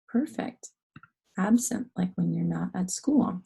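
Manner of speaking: whispered praise and explanation; hushed teacher whisper. Real, not acted. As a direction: breathe it out as a hushed aside.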